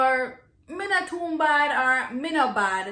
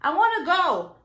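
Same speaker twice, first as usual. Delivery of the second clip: put-on little kid; 7 semitones higher, 5 dB louder than usual. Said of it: "there comes a little kid".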